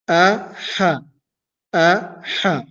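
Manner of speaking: usual speaking voice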